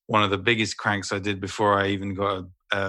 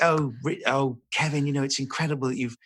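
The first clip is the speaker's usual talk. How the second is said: higher, gushing producer voice; BBC producer; 4 semitones higher than usual. Retold or imitated. Producer courting a star for Richard the Third, gushing. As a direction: imitate an eager radio producer. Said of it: "head of radio drama and then a, a producer".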